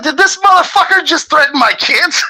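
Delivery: retold high squeal of outrage; outraged disbelief. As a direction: shriek it in stunned disbelief. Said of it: "I'm like".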